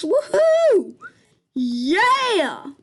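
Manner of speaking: high, loud woohoo cheer; excited cheer. Real, not acted. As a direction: whoop it out with joy. Cheering in game after change.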